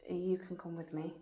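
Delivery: soft nervous whisper. Real, not acted. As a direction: keep it under your breath. Whispering during a ghost hunt.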